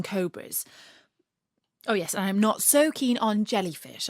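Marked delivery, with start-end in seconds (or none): none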